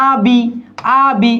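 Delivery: "আবি" in two held syllables; slow, separated "আবি" for a class; pronunciation demo. Shown as a demonstration. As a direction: stretch "আবি" so the final i rings out. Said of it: "ই উচ্চারণ হচ্ছে".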